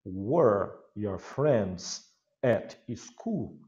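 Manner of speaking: "were your friends at school" drawn out very slowly; teacher drilling pronunciation for seventh graders; English pronunciation demo. Shown as a demonstration. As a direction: model the question slowly, word by word.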